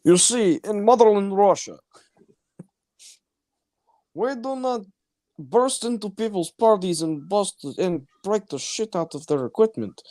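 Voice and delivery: high, hammed-up Russian accent, villain bravado; mock Russian accent. Joking to a friend as a Russian movie villain, thick Russian accent. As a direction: play a proud Russian thug in a thick Russian accent. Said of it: "I can kind of do a Russian accent".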